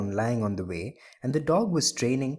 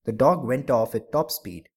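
ordinary delivery, nothing marked

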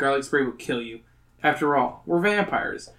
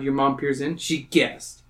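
ordinary talk, nothing marked

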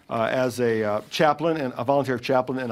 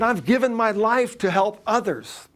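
higher, affronted and self-righteous; retold indignation; 8 semitones higher than usual. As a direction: protest her hurt pride. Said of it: "She said".

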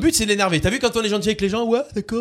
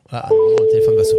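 excited; 6 dB louder than usual. Real, not acted. Announcing the prank call.

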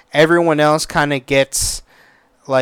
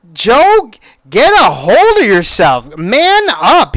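self-scolding; 6 semitones higher, 4 dB louder, slower than usual. Retold or imitated. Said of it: "I was like".

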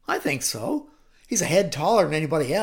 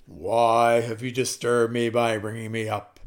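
low, louder ghostly voice; Samuel's spirit; 6 semitones lower, 5 dB louder than usual. Acted. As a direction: intone it like a disturbed spirit. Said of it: "Samuel said to Saul".

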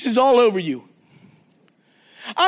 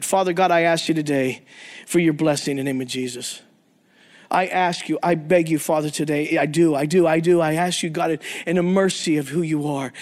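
lower, measured prayer voice; earnest prayer; 8 semitones lower than usual. Acted. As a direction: pastor praying over the men. Closing prayer.

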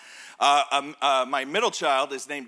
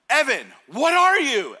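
loud, high, slow challenge; rallying dad; 7 semitones higher, 7 dB louder, slower than usual. Retold or imitated. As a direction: call out slowly to rally your son. Dad challenges son mid-panic.

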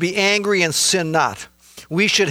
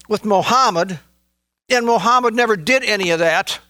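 fervent preaching; 7 semitones higher than usual. Acted. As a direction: lift it up as a fervent testimony. Preaching passionately about Jesus.

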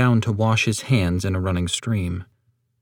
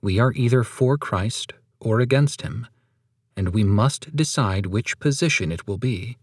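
normal talking voice

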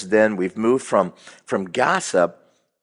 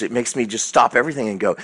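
usual speaking voice

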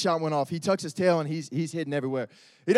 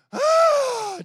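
sudden high wail imitating a frightened baby; mock baby wail; scared baby cry; 7 dB louder than usual. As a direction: shriek like a baby startled by the shower.

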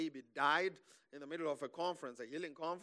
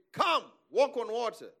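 loud, raised-pitch summons; calling out; 6 semitones higher, 7 dB louder than usual. Acted. Jesus calls friends from the boat, loud summons. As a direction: call across the water as Jesus.

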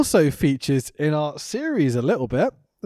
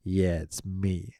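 lightly voiced Piper, much lower, softer and slow; Roddy Piper impression; 8 semitones lower, 8 dB quieter, much slower than usual. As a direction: drawl it like a veteran peeking out from behind the curtain. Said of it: "sticking his head around the entrance and going".

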